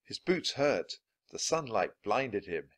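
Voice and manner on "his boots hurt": ordinary delivery, nothing marked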